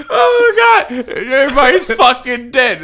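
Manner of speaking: high, loud laughter breaking through the words; helpless real laughter, high and loud; laughing hard. Real, not acted. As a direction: crack up while trying to talk. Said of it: "Be laughing like".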